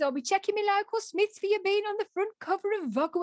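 put-on high-pitched elderly Dudley neighbour; Dudley accent. Neighbour vows to check local Smiths, Dudley accent. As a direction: sing it out in broad Dudley. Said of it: "she spoke like me".

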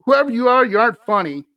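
high, loud angry shout at the intruder; scared husband shouting through the door; husband yelling. Retold or imitated. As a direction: yell it at whoever is outside. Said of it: "my husband yells".

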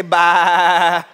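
long, drawn-out low baa; sheep bleat. Shown as a demonstration. Preacher leading the congregation as sheep, drawn-out bleat. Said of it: "everybody in the building to say".

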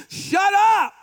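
high, loud mock yell; mock shout. Preacher playfully yelling shut up at the congregation.

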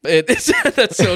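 laughing in agreement; real chuckle running through the words. Real, not acted. Laughing agreement with co-host's joke.

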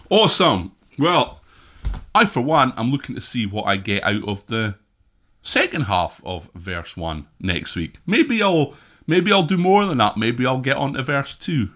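usual speaking voice

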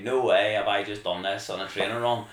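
loud, amazed, a laugh breaking in; loud disbelief with a chuckle; excited disbelief. Retold or imitated. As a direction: say no way as if you can't believe it. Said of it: "I was, like, screaming".

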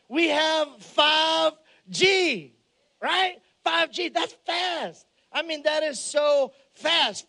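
slow excited emphasis. Real, not acted. Pastor marveling to the congregation at 5G speed. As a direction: savor every word of how fast it is.

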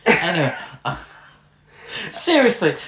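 ordinary talk

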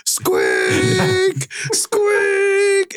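high-pitched, drawn-out squeaky heckle; imitating a fan yelling through a traffic cone; imitated heckling yell. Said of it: "they would yell through that cone".

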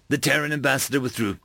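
ordinary delivery

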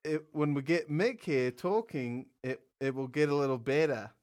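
shaky attempt at an Australian accent; amateur mock-Australian accent. Shown as a demonstration. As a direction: do a bad Aussie accent, drifting toward English. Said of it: "ready for the Australian accent".

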